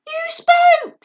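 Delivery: very high, loud little owl; gotcha, squealed; triumphant owlet. A narrator's reading. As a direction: owlet catching out his father, squeaky.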